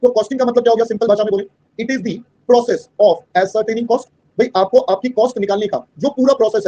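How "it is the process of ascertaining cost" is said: very slow, word by word dictation; dictating a definition to students. Shown as a demonstration. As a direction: spell it out slowly for notes. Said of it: "dictating definition".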